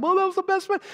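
high-pitched mock gushing praise; gushing churchgoer. Churchgoers praising the pastor's sermon, mock gushing.

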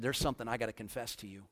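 low, soft, quick admission; retold tearful confession. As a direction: confess it quietly, understated sorrow. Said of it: "I just began to weep".

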